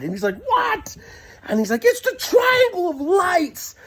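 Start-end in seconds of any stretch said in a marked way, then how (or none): 0.5-1.0 very high-pitched retold "what"; exasperated cry. Driver yelping back at the panicking passenger, acted high. 1.8-3.7 high, drawn-out hammed-up wail; excited cry. Passenger announcing the lights to the driver, high acted alarm.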